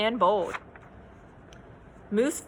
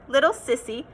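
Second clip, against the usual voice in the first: usual speaking voice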